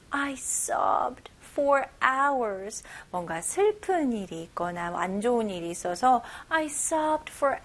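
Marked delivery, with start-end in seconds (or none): 0.1-2.8 whispered slowly, no voice. Shown as a demonstration. Teacher repeats the example sentence for her class in a whisper. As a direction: breathe the line out as a slow whisper. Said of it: "첫 번째 문장 다시 한번 볼게요".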